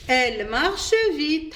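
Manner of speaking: very slow, each French word laid out clearly; slow model reading. Shown as a demonstration. Teacher reads the example sentence to pupils, slowly. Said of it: "إذن نمشيو بالجملة".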